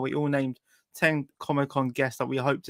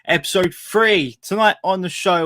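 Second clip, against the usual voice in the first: loud, upbeat host welcome; show host intro; 4 semitones higher, 9 dB louder than usual. Acted. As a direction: welcome the audience like a presenter. Show host opening over music.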